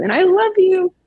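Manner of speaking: gushing. Real, not acted. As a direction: burst out with affection. Heartfelt closing to host.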